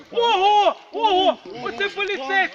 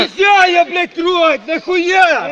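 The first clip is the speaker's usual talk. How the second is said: loud, heated protest; angry shouting; 6 dB louder, slower than usual. Real, not acted. Detained man protests being touched by police, angry shout. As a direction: yell in outrage at being handled. Being grabbed during arrest.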